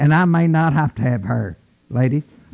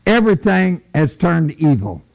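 normal talking voice

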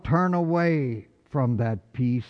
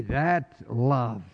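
ordinary talk

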